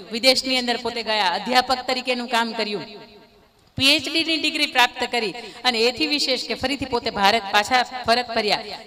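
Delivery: ordinary talk, nothing marked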